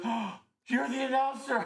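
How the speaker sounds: high, delighted recognition; thrilled fan recognizing a voice; surprised gasp. Retold or imitated. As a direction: gasp and go way up in pitch.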